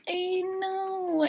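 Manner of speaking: high, soft, drawn-out reply; mock coy contestant. Imitates flattered contestant.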